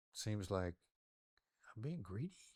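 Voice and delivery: softened questioning tone. Shown as a demonstration. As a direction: float it as a gentle question. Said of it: "I'll make it a question".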